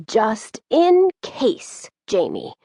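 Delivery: put-on an old grandmother's rasp, rising then dropping; raspy old lady. A narrator's reading. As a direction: draw it out like a raspy old woman. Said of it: "in her raspy old lady voice".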